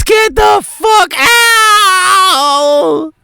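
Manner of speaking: drawn-out crying scream; screaming at top of lungs. Acted. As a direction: scream it with everything. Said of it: "Yelling at the top of her lungs".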